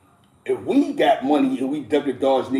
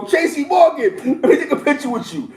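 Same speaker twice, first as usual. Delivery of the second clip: high, laughing fan begging a picture; excited laughing fan asking Tracy Morgan for a picture; mock starstruck fan; 11 semitones higher, 4 dB louder than usual. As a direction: gush like a groupie at a celebrity.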